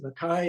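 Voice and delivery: Mackay rhyming with "sky"; pronounced Mackay. Shown as a demonstration. Author joking about Scots names, Mackay rhyming with sky. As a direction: say Mackay as muh-KYE. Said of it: "pronounce it however you like".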